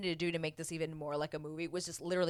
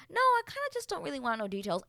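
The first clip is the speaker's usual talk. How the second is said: polite refusal; 8 semitones higher, 3 dB louder, faster than usual. Retold or imitated. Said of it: "Aaliyah's just like".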